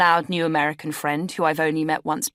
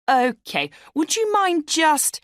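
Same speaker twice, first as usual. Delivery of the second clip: awkward polite stalling; 11 semitones higher, 4 dB louder, slower than usual. A narrator's reading. Awkwardly shooing dealer out.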